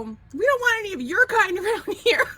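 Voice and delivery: hollering it out of a Jeep as a prank; retold playful yell; joke yell. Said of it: "I yelled it out the top of the Jeep".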